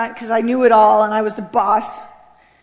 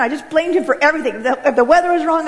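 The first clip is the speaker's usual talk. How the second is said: ordinary delivery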